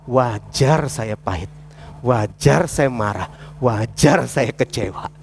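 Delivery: slow, low, dragging out each "wajar"; bitter self-justification. Acted. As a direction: imitate a bitter person justifying themselves. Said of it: "Itu orang pahit". (nada pahit, lambat dan rendah)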